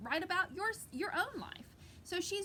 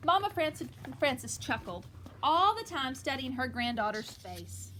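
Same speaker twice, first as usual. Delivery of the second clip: louder read-aloud storytelling voice; lively picture book reading; story reading; 8 dB louder than usual. Acted. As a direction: read it out to the kids with energy.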